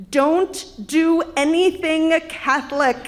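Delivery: loud, emphatic warning to a friend; forceful, panicky instruction; urgent warning. Acted. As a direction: order it firmly, word by word. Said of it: "grabbed my shoulders and said".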